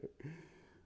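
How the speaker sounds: quick airy laugh; breathy laugh. Real, not acted. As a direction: let out a short breathy laugh. Laughs after punchline.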